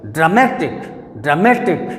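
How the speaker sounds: "Dramatic" with the weight on मे; stress on MA. Shown as a demonstration. Teacher confirming middle-syllable stress, "dramatic" stressed on मे. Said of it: "बीच वाले पर".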